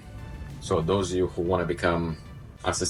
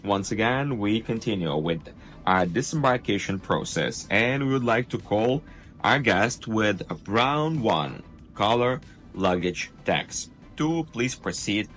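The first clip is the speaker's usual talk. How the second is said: upbeat cruise director; 4 semitones higher than usual. Acted. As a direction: welcome the guests over the ship speakers. Ship PA announcement read.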